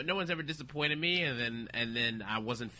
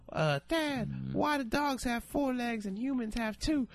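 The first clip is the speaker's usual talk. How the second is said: put-on higher, slow little kid's voice; imitating child; 9 semitones higher, slower than usual. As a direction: ask it like a curious little kid. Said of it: "they're gonna be like".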